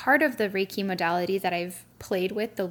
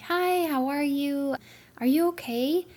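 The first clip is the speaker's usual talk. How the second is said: gentle greeting; 6 semitones higher than usual. Retold or imitated. Approaching stressed mom gently.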